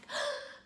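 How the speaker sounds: sharp high gasp of realization. Acted. Adina realizing he loves her, high sharp gasp. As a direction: gasp as the truth hits.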